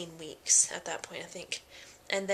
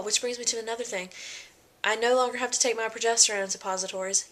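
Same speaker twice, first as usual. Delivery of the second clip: ordinary delivery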